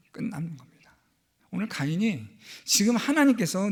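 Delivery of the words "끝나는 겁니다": hushed warning whisper. Real, not acted. Preacher warns the congregation, whispered. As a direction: breathe the words as a grave warning.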